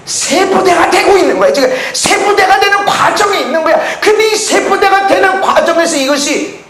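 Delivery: high, loud fervent preaching; preaching at full force. Acted. Preacher urging the congregation about the new wineskin, fervent. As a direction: drive it home.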